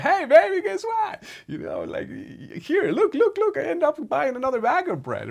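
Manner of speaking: high, loud, giddy and bursting with news; excited greeting. Retold or imitated. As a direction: show off the bread like a proud kid, nearly squealing. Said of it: "and I'd be like".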